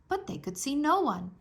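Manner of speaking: plain reading voice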